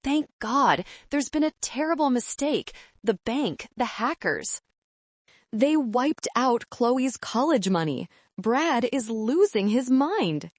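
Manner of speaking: high, rushed panic, lightly voiced; a hint of a frantic mother; near-hysterical mother. A narrator's reading. Mother panicking about the hackers, mildly frantic. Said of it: "My mother's voice was shrill, bordering on hysteria".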